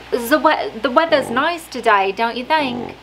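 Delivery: put-on off-target Australian accent; failed Australian accent. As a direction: have a shaky go at an Australian accent, missing it. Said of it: "No, that's not Australian".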